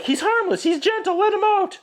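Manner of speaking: very high mock pleading girl voice; high Kitty voice. Kitty pleading with her father for Mowgli, high mock voice.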